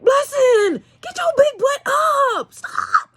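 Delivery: high-pitched exasperated yell at a dog; acted scolding shout. Owner telling the dog to get off her belly, scolding shout. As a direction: re-enact yelling an order, voice leaping up.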